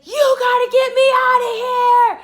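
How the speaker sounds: very high, drawn-out loud yell; imitated desperate scream. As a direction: scream slowly for rescue, as imitation. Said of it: "He's screaming, he's crying".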